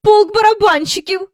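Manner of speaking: high startled exclamation; alarmed cry. Acted. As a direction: gasp it out in alarm.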